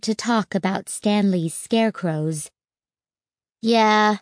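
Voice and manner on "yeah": ordinary delivery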